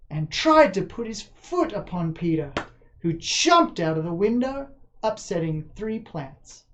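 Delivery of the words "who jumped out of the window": ordinary delivery, nothing marked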